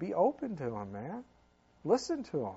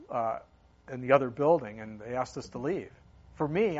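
ordinary talk, nothing marked

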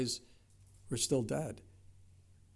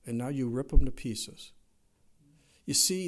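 usual speaking voice

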